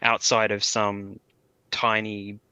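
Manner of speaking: ordinary talk, nothing marked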